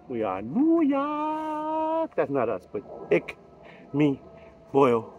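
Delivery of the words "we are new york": slow, much higher sing-song call; drawn-out slogan. Acted. Host closing the show slogan, slow and high. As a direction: stretch it out like a hype chant.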